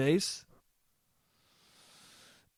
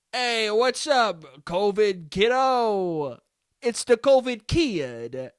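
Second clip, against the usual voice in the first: slow, higher, stretched-out goofy call; mocked dad greeting; 5 semitones higher, much slower than usual. Son mimicking dad's nickname greeting, hammed-up. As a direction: draw out every word as a cheesy hail.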